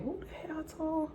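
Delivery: high, hushed disbelief; soft, high aside to oneself; confused. Retold or imitated. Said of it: "You know, I'm confused".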